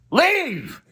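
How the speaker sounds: hammed-up high-pitched scream; mock scream. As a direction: imitate a screaming woman's one-word order. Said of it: "she's screaming at me".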